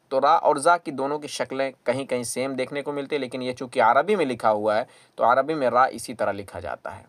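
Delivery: ordinary delivery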